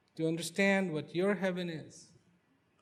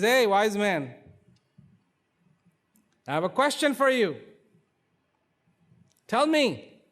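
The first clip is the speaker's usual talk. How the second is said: higher, louder call to the wise man; 7 semitones higher, 8 dB louder than usual. Acted. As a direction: hail him from the horse, demanding. King calls out to wise man.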